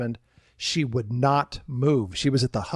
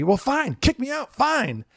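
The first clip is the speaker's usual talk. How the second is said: much higher, petulant mock whine; defiant guest; 10 semitones higher, slower than usual. Guest daring security to eject her, high petulant whine. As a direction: toss it off like a sulking child.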